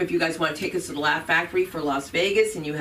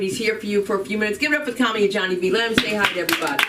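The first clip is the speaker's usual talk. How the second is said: fast host intro; 3 semitones higher, much faster than usual. Real, not acted. Host introducing next comic.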